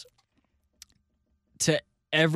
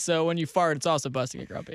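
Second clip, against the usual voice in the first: normal talking voice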